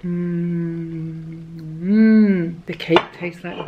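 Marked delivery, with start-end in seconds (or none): 0.0-2.5 yum. Real, not acted. Tasting the dessert.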